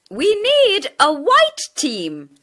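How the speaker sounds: teacher asking for a team. A narrator's reading. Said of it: "teacher speaks".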